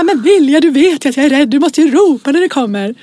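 mock yell of a frightened parent; retold startled scolding. Scared mother to daughter, shouted scolding. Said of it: "jag skriker".

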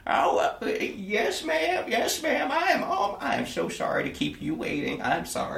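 put-on high, hurried apologetic old man; flustered servant. Servant answering his demanding mistress, high and flustered. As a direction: scramble to apologise to the madam. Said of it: "he, he goes".

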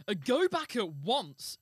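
loud, slow royal command; king's voice. A narrator's reading. King sending his servant back, loud commanding voice. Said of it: "said His Majesty".

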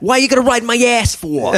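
high, loud defensive New York rant; hammed-up New York rant; put-on New Yorker. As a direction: shout back like a worker who is sick of it. Mock phone quarrel continues.

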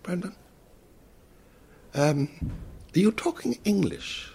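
lightly mimicked posh English voice; English fella. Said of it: "and he said".

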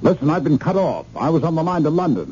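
high-pitched, urgent complaint; agitated complaint. Acted. Caller cut off from London berates operator, agitated. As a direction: press the operator, voice pitched well up.

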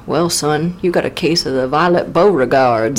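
a doctor breaking the news, folksy; mock country doctor. Doctor answers, acted scene.

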